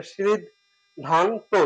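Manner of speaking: সিদ, ধান, তো in separate beats, last ending in o; syllable-wise pronunciation. Shown as a demonstration. Teacher correcting the written split, সিদ, ধান, তো spoken separately. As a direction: pause between সিদ, ধান and তো. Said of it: "উচ্চারণ অন্যভাবে হবে".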